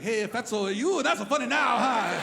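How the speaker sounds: parking lot taunt. Retold or imitated. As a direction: taunt the comic after the show.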